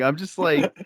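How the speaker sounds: ordinary talk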